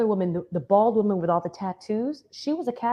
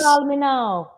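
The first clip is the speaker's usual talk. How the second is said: put-on light Jamaican accent, louder; a hint of a Caribbean psychic; 5 semitones higher, 5 dB louder, slower than usual. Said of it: "do the Jamaican accent".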